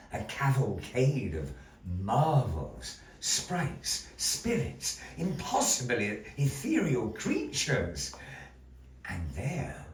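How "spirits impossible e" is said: ordinary delivery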